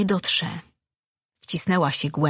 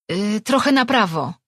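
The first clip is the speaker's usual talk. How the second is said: mild call to the side, a bit raised; 4 semitones higher, 4 dB louder than usual. Acted. Rejwan guides the servant's light, mild calling out. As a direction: call the direction back to the servant. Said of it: "zawołała".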